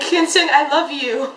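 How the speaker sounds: high, slow drawn-out call; fangirl confession. Retold or imitated. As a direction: call out love to an idol. Reenacting shouted confession to idol.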